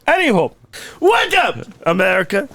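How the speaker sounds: ordinary delivery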